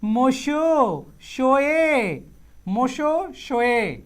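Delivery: slow, drawn out মোশো শোয়ে, each syllable stretched; slow teacher demo of মোশো শোয়ে; careful pronunciation. Shown as a demonstration. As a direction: say মোশো শোয়ে slowly and clearly, stressing মো. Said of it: "এই মোশো শোয়ে উচ্চারণটা বলেন".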